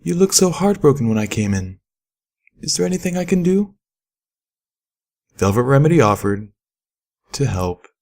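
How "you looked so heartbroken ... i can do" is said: higher, gentle lilting mare voice; sweet singer's voice. A narrator's reading. Velvet Remedy kindly offers help to Pip. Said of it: "in that amazing voice".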